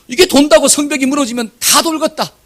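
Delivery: high, sneering sarcasm; mock complainer pitched high; sarcastic grumble. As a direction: scoff at the idea the walls fall.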